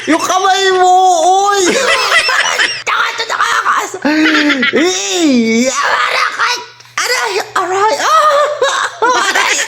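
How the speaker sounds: squealing, laughing high-pitched protests; being tickled. Acted. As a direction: shriek and giggle while tickled. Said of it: "Nakikiliti ako!".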